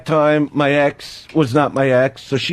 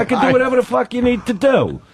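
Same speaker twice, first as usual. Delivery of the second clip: high-pitched cocky insistence; mock boast; 9 semitones higher than usual. Client bragging to agent that he's Superman, high mock boast. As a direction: insist on your powers, pitch jumping up.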